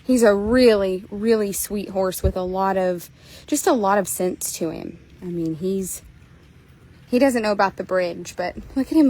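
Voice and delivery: ordinary talk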